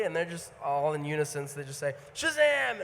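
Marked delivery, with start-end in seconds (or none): 2.1-2.8 loud group shout of Shazam. Retold or imitated. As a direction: yell it out in unison. Cast shouting in unison.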